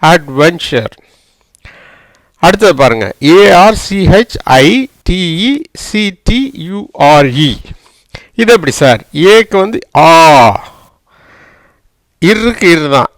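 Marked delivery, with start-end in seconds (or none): 3.2-7.6 slow, separated letter names; spelling out. Shown as a demonstration. Teacher reads the next word to the class, spelling slowly.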